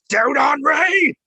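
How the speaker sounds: high, slow, shouted battle cry; Mercenary Tao voice. Retold or imitated. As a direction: bellow it out like a blunt world-weary mercenary. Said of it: "I mainly learned to do Mercenary Tao's voice because I would just shout it".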